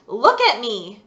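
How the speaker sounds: a stern father raising his voice; loud stern command. Acted. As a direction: bark Look at me on the second try. Repeated louder, stern command.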